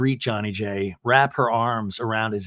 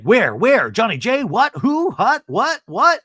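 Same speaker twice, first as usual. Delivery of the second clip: rising high, louder what-whats; hammed-up shocked chorus; mock shock; 9 semitones higher, 6 dB louder, slower than usual. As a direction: gasp out each what higher. Everyone reacting shocked.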